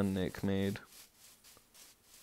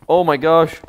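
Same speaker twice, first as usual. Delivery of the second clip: loud alarmed cry; startled shout; 6 semitones higher, 13 dB louder than usual. Real, not acted. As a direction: yell it in sudden alarm. Sudden danger in game.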